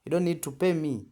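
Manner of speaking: ordinary talk, nothing marked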